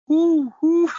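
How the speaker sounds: loud high whoo hoots; owl hoot. Pretend. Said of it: "can't turn their heads three hundred sixty".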